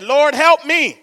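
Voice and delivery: loud, high plea for help; acted desperate cry to heaven; pleading. Hanging man begging the Lord, pleading. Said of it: "The man said".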